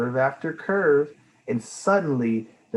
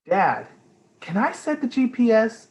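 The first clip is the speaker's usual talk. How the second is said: put-on child voice; 10 semitones higher, 5 dB louder than usual. Child Amelia asks eagerly.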